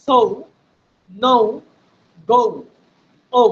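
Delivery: সৌ নৌ গৌ ও with a rounded gliding ও; O sound demo. Shown as a demonstration. Teacher drills the rounded O sound in example syllables. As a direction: round and glide each ও. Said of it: "এখন থেকে বলবো ও".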